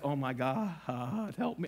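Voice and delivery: low, soft mock dismay; weird dismayed groan. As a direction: groan it to yourself in mild horror. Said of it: "I said it in a weird way".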